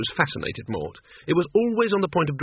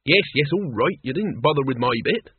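plain reading voice

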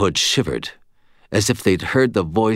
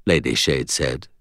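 usual speaking voice

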